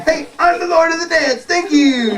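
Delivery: high, quick cartoon show-off; boastful dog. Dancing dog to his audience, high boastful voice. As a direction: crow it high and fast.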